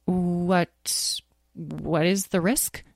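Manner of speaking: quiet, hesitant question; nervous. Acted. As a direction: nervously stammer it out. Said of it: "asked Tom nervously".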